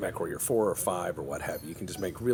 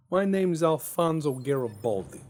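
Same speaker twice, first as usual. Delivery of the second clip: hammed-up Italian-American accent, higher and louder; mock Italian-American; 8 semitones higher, 6 dB louder than usual. Joking with his viewers, a hammed-up Italian-American accent. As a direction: ham up an Italian-American wiseguy introduction.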